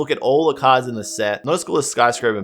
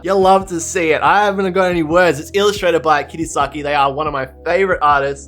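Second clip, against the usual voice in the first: loud elation; 5 semitones higher, 5 dB louder than usual. Real, not acted. Loud celebration of the hit.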